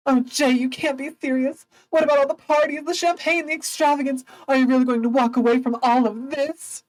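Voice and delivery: put-on high, pleading society girl voice; pleading Daisy. Daisy begging Jay not to leave her, high and pleading.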